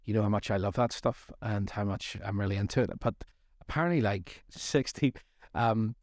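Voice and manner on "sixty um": ordinary talk, nothing marked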